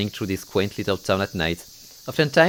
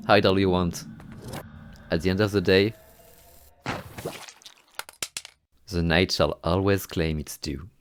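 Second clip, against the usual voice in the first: ominous narration; 2 semitones lower, 4 dB quieter than usual. Acted. Narrator turns ominous as the spirit returns. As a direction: let the night threaten. Ominous echo of earlier lines.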